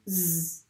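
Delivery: Z as a buzzing zzz; Z sound. Shown as a demonstration. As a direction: hold the buzz on Z. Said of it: "on le prononce bien". (le son Z bien appuyé)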